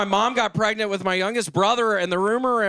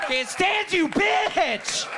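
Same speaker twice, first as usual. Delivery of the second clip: mock shouted insult; 8 semitones higher, much slower than usual. Comic insults [name] loudly.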